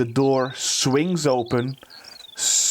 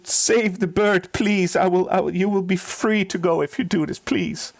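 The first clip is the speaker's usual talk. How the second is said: high, tearful acted begging; desperate pleading; 6 semitones higher than usual. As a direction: beg for your dying pet's life. Crying steward begs player to help.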